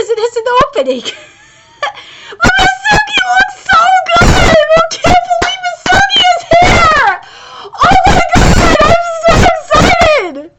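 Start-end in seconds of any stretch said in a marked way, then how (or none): 2.3-10.5 high, loud squeal of delight; thrilled shout. Real, not acted. Fan seeing Misugi animated, ecstatic high shout. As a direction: yell it like your favourite just walked in.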